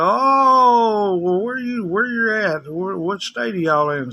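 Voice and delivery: high-pitched imitation of curious callers. Callers asking a call center worker where he is, curious and high. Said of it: "these people would go".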